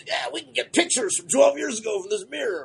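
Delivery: mocking a show character's ridiculous boast; mock character line. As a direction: ham up the silly science claim. Mocks a character's absurd science line.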